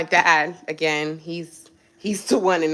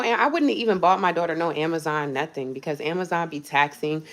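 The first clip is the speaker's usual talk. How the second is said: normal talking voice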